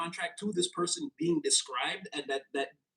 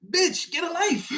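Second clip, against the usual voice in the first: high, loud, slow sassy put-down; sassy clapback; 11 semitones higher, 5 dB louder, slower than usual. Pretend. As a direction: drag it out like a comment-section clapback. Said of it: "who in the comment section talks like this".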